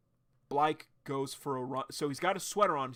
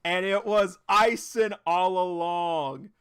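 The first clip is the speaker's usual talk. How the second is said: dramatic reveal; 6 semitones higher, 11 dB louder, slower than usual. Real, not acted. Reveal of Ison as the stalker.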